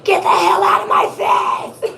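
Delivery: high, strained yell; hammed-up outburst, pushed hard and high; mock shout. Reader playing up her strained voice for viewers, mock shout.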